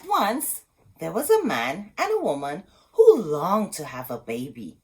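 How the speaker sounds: ordinary delivery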